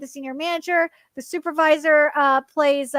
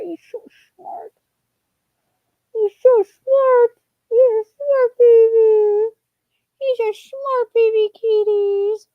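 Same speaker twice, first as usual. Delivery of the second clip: high, slow, slurred coo; 7 semitones higher, 6 dB louder than usual. Real, not acted. Streamer praises the smart cat, high slurred baby talk. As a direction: draw out each word in squishy pet-talk.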